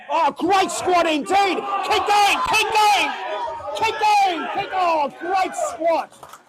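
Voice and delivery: loud, high cheering over the lift; excited shouting. Real, not acted. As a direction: keep yelling them through the squat. Announcer cheering big squat.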